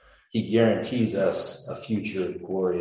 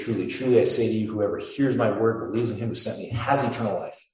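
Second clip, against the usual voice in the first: forceful scripture; 4 semitones higher, 6 dB louder than usual. Acted.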